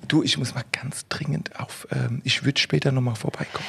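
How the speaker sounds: leise flüsternd, eilig; flüstere es verschämt. Acted. Dringend aufs Klo, flüstert. (geflüstert)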